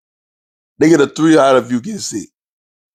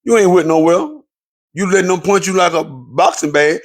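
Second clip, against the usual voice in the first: ordinary talk, nothing marked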